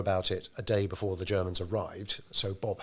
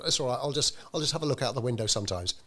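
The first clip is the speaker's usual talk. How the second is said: higher, offhand brush-off; cantankerous Bill; 6 semitones higher, faster than usual. Retold or imitated. Bill dismissing Bob's order to hide, higher and offhand. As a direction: wave away the warning, lightly voiced grump. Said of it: "a fairly cantankerous character".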